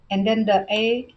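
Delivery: ordinary delivery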